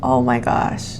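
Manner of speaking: barely getting it out while crying. Real, not acted. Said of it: "The tears, they won't stop".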